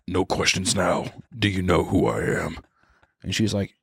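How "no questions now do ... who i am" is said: menacing Walter. Retold or imitated. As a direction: lean in low and threatening. Walter intimidating the girl.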